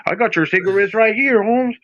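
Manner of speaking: mock Mexican accent. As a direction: parody him with a Mexican accent. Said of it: "too natural how you said that".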